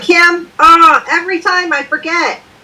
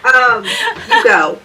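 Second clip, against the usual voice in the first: laughed out, slow and higher; laughing; 8 semitones higher, much slower than usual. Real, not acted. Cohost laughing as she hands the floor back, slow and higher. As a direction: let the laugh carry the words. Laughing while yielding turn.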